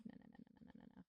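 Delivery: breathless whisper of no no no; whispering refusal; whispered no's. Real, not acted. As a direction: hush it out as a panicked whisper. Muttering denial unvoiced.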